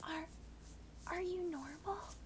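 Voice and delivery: scared neighbor checking on you; scared whisper. Acted. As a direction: stammer it out, frightened and hushed. Shelley asks, scared.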